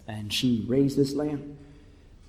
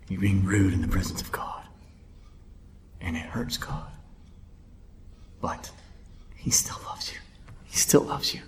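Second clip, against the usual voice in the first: low, intense whisper; hushed gravity; 7 semitones lower, 4 dB quieter than usual. Real, not acted. Preacher telling listeners God still loves them, hushed. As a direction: drop to a whisper for the solemn truth. Grave hushed rebuke, then assurance.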